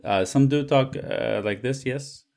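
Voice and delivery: put-on French accent, halting; hesitant French-accented English. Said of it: "me doing that accent in".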